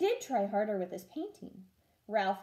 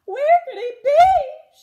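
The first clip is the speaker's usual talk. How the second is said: high, loud, slow wail through tears; weeping mother; 17 dB louder, slower than usual. Acted. Mother wails for her missing son, tearful and high. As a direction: sob the question out to the night. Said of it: "His mother wept and wrung her hands".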